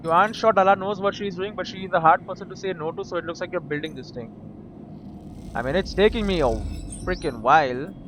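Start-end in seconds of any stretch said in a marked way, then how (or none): 0.0-4.3 reading the game's text aloud, evenly; steady reader's voice for the game; story narration. Acted. Reads game text again.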